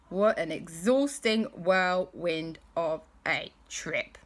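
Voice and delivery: plain reading voice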